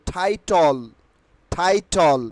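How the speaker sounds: টাইটল as "thai-tol", puffed first T; drawn-out টাইটল with ঠ first and an "-ol" ending; aspirated T. Shown as a demonstration. Teacher modelling the corrected word for students, aspirated T. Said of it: "টাইটেলের উচ্চারণ কি হবে".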